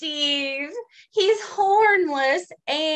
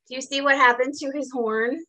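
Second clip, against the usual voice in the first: ordinary delivery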